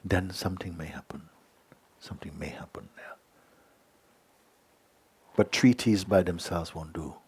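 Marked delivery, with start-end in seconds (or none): 2.0-3.2 whisper. Real, not acted. Echoing his own line softly.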